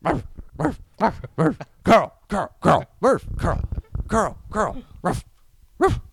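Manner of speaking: very high, strangled repeated cries; mock frantic calling. Father calling for his child, in hammed-up high-pitched cries. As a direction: squeak the names out over and over.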